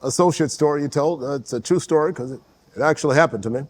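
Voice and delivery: halting, measured presidential impersonation; Barack Obama. Acted. Correcting the host about a story, Obama impression. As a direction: pause and hedge like the mimicked president. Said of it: "But then you won't do your Barack".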